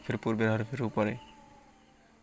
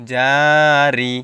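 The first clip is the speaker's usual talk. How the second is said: very slow, louder chanted recitation; Quran recitation; 9 dB louder, much slower than usual. Shown as a demonstration. Teacher building up a word, chanting it slowly. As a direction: stretch the word out like tilawat. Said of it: "রজের রি".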